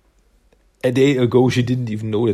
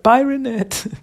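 high, louder chirpy bye; cheery goodbye; 13 semitones higher, 4 dB louder, slower than usual. Retold or imitated. Mike saying goodbye to Renette, high chirpy farewell. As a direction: wave her off brightly. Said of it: "And Mike is like".

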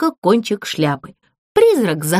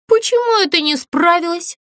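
high, puzzled puppet voice; bewildered puppet; 8 semitones higher than usual. Acted. Puppet asks the ghost why she failed, puzzled. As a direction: caught off guard. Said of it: "растерялась Злюня".

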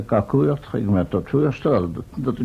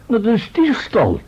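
higher, slow and weighty accusation; indignant outrage; 7 semitones higher than usual. Acted. Professor denouncing the theft to his companion, indignant. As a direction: let the outrage swell on each word.

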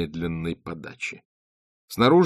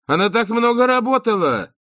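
a sharply raised, slowed-down exclamation; exclaiming; 9 dB louder than usual. Acted. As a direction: shout her back to her senses. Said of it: "воскликнула она".

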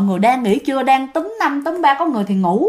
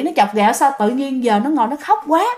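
ordinary delivery

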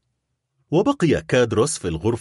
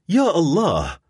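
higher-pitched exclamation, only mildly raised; crying out; 7 semitones higher than usual. A narrator's reading. Son learns how little money his father had, crying out. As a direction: cry it out in narrated dismay. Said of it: "فصاح أدمون".

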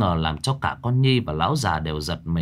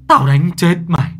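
loud, higher furious yell; furious shout; 8 semitones higher, 9 dB louder than usual. A narrator's reading. Grandfather screams at Nhi while slapping her, furious. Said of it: "ông vừa vả ông vừa chửi".

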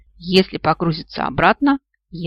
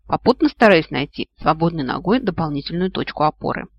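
usual speaking voice

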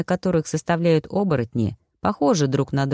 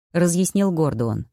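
ordinary delivery

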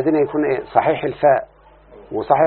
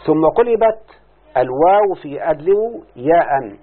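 slow dictation; slower than usual. Shown as a demonstration. Teacher dictating rule slowly.

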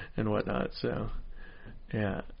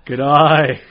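a quick Australian greeting, a bit louder; Australian accent; 7 dB louder than usual. Retold or imitated. Comic showing off his Australian bit, Australian accent. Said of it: "I do the Australian thing".

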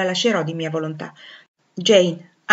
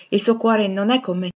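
plain reading voice

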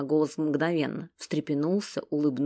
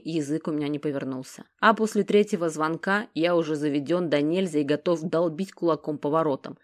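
usual speaking voice

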